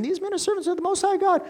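high, fast, squeaky girl mocking. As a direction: rattle it off in a grating squeak. Girl mocked again in retelling.

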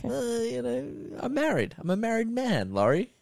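mocking Craig refusing her advances. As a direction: play a prissy husband brushing her off. Voicing Craig rejecting Laurie.

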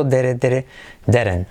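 dere, dere, then didn't with a tapped r sound; dere drill. Shown as a demonstration. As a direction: say didn't like the start of derecho. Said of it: "Como derecho".

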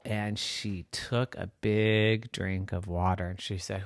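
ordinary delivery

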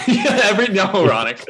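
laughing out the words; high, loud through laughter. Real, not acted. Laughing after winning tiebreaker.

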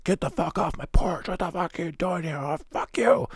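high, mock furious yelling; an angry stranger chasing kids off his porch, mock rage; irate homeowner. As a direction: bark them off the porch, hammed-up. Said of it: "So they're like".

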